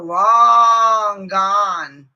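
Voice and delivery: long gone stretched out very slowly and loud, almost wailing; exasperated cry. Real, not acted. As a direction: wail it out in frustration. Venting about bad moderators.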